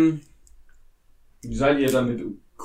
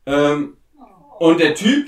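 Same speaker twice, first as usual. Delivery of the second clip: ordinary talk, nothing marked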